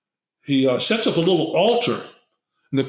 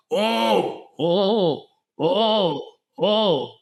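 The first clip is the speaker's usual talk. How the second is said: high moaning oh repeated like an animal call; jaguar-like wail; 5 semitones higher, much slower than usual. Retold or imitated. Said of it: "Sound like a jaguar mating call".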